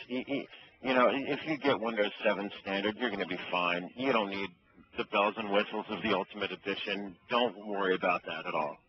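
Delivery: ordinary talk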